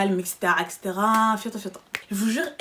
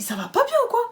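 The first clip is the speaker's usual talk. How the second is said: outraged retort; 14 semitones higher, 4 dB louder than usual. Retold or imitated. Shouting at the supervisor.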